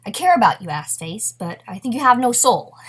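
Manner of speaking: sudden loud mock outburst; bursting out. Woman finally confronting her aloof lover, bursting out. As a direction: blurt it out loudly, leaning hard on the ends. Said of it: "never bursts out and says".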